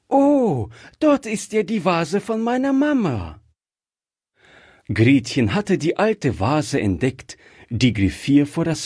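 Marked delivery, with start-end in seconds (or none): 0.1-3.4 put-on very high, delighted child exclamation; child's sudden cry. A narrator's reading. Gretchen spotting her mama's vase in the shop, high excited exclamation. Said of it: "Plötzlich rief das Kind laut".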